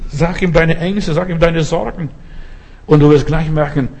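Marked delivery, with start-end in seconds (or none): none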